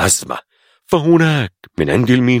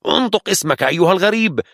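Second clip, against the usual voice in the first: high, edgy demand; nervous anger; 9 semitones higher, faster than usual. A narrator's reading. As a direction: snap the order at him. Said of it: "قال له الجندي بعصبية".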